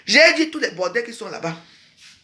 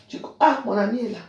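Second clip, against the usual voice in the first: normal talking voice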